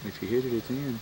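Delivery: ordinary talk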